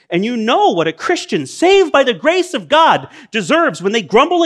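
high, loud, rising preaching; impassioned preaching. Real, not acted. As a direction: preach it with full force to the crowd. Preacher building to a climax.

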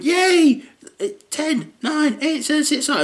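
put-on squeaky kid counting down to midnight; high excited kid voice. Son counting down his birthday, high and giddy. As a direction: chirp it out like a giddy twelve-year-old.

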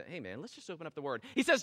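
ordinary talk

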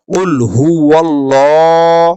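ওয়াল্লাহ intoned slowly with a thick, heavy লা; Quranic recitation. Shown as a demonstration. Teacher reciting the verse for the class, slow chant. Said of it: "এখন এই লামের খাড়া জবরটাকে আমরা কি পড়ব?".

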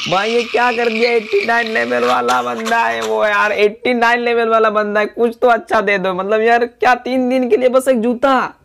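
dismayed complaint. Real, not acted. Gamer protesting a measly level eighty-nine reward, dismayed complaint. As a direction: plead in disbelief, stretching the words out. Mock outrage at a one-shoe reward.

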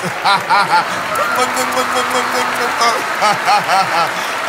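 long, rolling belly laugh; hearty laughter. Real, not acted. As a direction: crack up and keep laughing. Cracks up after greeting audience.

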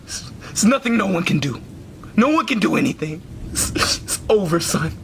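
low, defeated resignation; hopeless. Acted. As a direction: give up completely, voice sunk down. Depressed father gives up.

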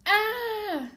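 short, loud, high "ah"; mock scream. Teacher acting a fright for the class, mock scream. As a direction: cry out in playful panic. Running away in story.

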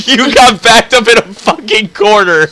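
loud laughing shout. Real, not acted. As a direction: laugh through the words. Laughing at friend's plight.

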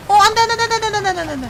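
high, loud panicked pleading. Real, not acted. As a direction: panic as she falls. Character falling in game.

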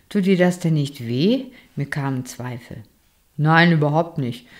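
ordinary delivery, nothing marked

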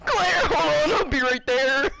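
hero's line with a sob; crying heroic call. Acted. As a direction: shout it near tears. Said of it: "Leon's lines".

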